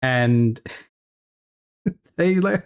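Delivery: ordinary talk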